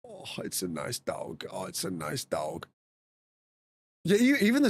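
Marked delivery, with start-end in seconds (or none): 0.1-2.7 whispered admiration. Real, not acted. Soft repeated admiration.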